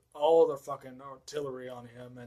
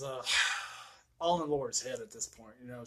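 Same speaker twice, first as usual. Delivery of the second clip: ordinary delivery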